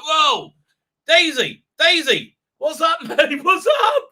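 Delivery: high, laughing calls to the dog; half-laughing, woken up and calling the dog; startled call to dog. Retold or imitated. Woken at four, calling to barking dog Daisy, high and laughing. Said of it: "I was like".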